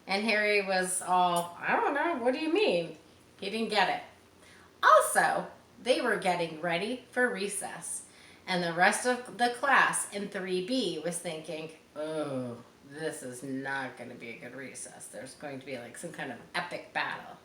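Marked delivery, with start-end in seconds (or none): 1.6-3.0 put-on high, puzzled boy voice; a lightly voiced confused kid; confused Harry. 11.9-17.4 low, hushed gloom; a low, quiet worried hush; dreading classmates. Acted.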